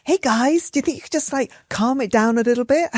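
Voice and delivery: hammed-up super-polite cool-mum plea; calmest, nicest voice. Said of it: "in the calmest, nicest voice I could possibly say".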